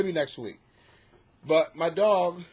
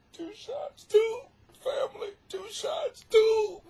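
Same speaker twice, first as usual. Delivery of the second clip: high squeaky two shots; outraged high disbelief; slower than usual. Real, not acted. Dad outraged his tiny dog got two shots, squeaky high.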